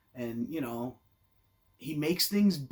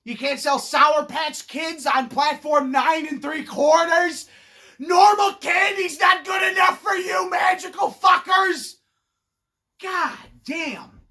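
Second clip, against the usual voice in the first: louder, higher, slow outraged rant; ranting shout; 6 semitones higher, 6 dB louder, slower than usual. Real, not acted. Comedian yells at wizards over candy, louder and higher. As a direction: yell it at the magical fuckers.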